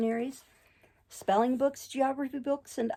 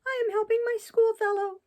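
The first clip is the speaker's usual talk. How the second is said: high puppet voice; 13 semitones higher, 5 dB louder than usual. A narrator's reading. Pinocchio answers carabineers.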